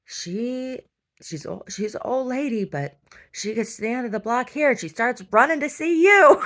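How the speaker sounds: imitating her mommy. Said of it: "her mommy says".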